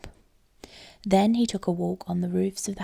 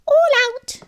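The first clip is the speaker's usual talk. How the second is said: very high, loud mouse cry; a mouse who cannot hold her tongue; mouse squeak; 10 dB louder than usual. A narrator's reading. Mouse blurts the last name.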